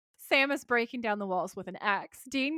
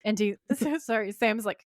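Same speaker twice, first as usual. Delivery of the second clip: ordinary talk, nothing marked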